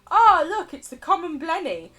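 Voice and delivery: excited. Retold or imitated. Fan spotting a fish in a video, delighted. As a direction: point it out with glee. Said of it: "and I'll be like".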